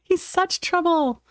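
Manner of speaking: lightly cooed mock complaint; fond pet voice. Real, not acted. Owner fondly grumbles about her cat, lightly cooing.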